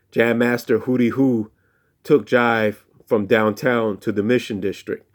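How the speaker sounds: ordinary delivery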